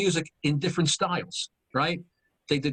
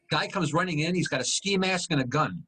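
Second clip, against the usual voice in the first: ordinary talk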